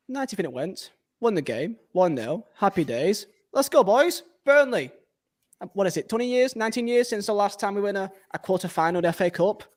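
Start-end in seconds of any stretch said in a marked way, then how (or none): 3.5-4.9 excited cheer. Real, not acted. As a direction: cheer the lads on. Celebrating a cup win.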